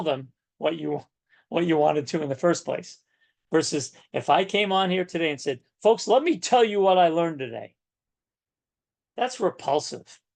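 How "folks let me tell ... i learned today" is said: loud, raised-pitch lecturing like a parent; mock preachy announcement, pitched up; bossy telling. Shown as a demonstration. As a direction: tell them like a boss, higher and forceful. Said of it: "That's a parent".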